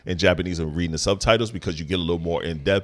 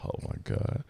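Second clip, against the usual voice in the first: whispered exasperation; 10 semitones lower, 13 dB quieter than usual. Real, not acted. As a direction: breathe it out unvoiced. Exasperated aside under breath.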